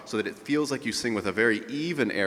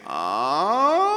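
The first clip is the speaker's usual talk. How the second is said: high crying ah, louder; a sobbing cry on a high ah; 10 semitones higher, 6 dB louder than usual. Shown as a demonstration. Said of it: "add a little bit of a cry".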